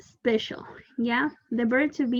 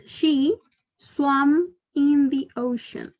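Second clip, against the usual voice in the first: slow model reading; 2 semitones higher, much slower than usual. Shown as a demonstration. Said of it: "teacher models the sentence slowly".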